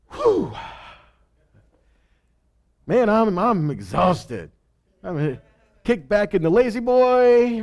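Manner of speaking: a hammed-up worn-out groan, then a lazy drawl; mock exhausted sigh. Preacher mocking a worn-out God resting. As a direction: play God as a tired man kicking back. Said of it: "we're like".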